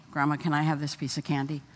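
lightly voiced toddler asking nicely; polite little boy asking. Grandson politely asking grandma for candy, soft and quick.

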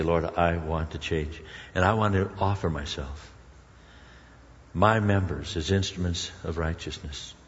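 low, soft prayer voice; earnest prayer. Acted. Preacher modelling a prayer to God, soft and low. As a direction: pray it quietly and humbly. Prays aloud in the sermon.

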